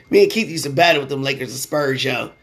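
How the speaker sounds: ordinary talk, nothing marked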